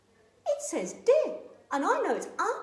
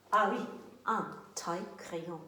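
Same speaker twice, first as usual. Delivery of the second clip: ordinary delivery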